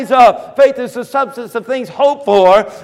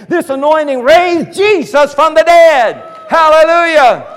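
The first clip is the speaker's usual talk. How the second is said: higher, louder triumphant proclamation; preaching shout; 6 semitones higher, 7 dB louder than usual. Real, not acted. Preacher hits climax of his point.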